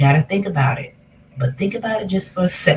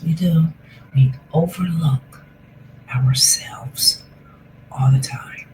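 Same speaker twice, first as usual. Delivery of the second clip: guided meditation; 3 semitones lower than usual. Acted. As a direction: ease them into the breath gently.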